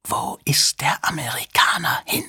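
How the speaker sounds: wheezing, menacing whisper; strange wheezing voice. A narrator's reading. Stranger at Two-Tone's ear demanding an answer, wheezing. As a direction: wheeze it out softly, almost without voice. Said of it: "mit merkwürdig keuchender Stimme".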